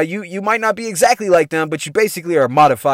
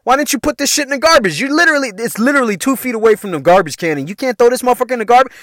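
acted-out outburst, climbing higher; retold snapping; 6 semitones higher, 3 dB louder than usual. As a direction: build from firm to high-pitched indignation. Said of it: "I was snapping on motherfuckers".

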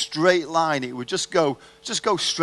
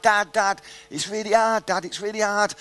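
whiny struggling son; 6 semitones higher than usual. Retold or imitated. Son struggling mid-length in pool.

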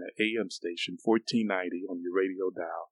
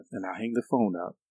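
ordinary delivery

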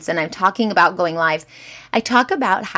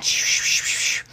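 soft unvoiced shushing of wind; wind noise shh; 3 dB quieter than usual. Shown as a demonstration. Imitating wind noise on a live stream. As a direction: hiss like wind over the mic. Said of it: "so you hear all that".

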